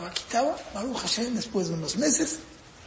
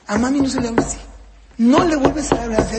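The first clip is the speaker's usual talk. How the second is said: forceful parental reprimand, slightly raised; very firm; 4 semitones higher, 5 dB louder, faster than usual. Acted. Father defends mother to disrespectful child, very firm. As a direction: lay down the law firmly. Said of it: "fuertísimo, fuertísimo, muy firme".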